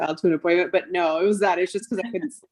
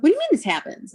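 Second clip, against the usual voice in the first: high-pitched incredulous question; incredulous retort; 10 semitones higher, faster than usual. Retold or imitated. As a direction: act out disbelief at the doctor's words. Retelling her shocked reply to doctor.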